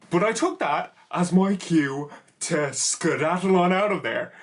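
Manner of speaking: normal talking voice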